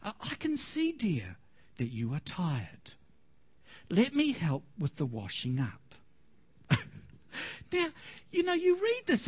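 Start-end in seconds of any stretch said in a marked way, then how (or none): 0.0-5.9 a lightly voiced considerate husband to his tired wife; gentle husband. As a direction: coax her kindly and quietly, a little lower. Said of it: "he might say to his wife".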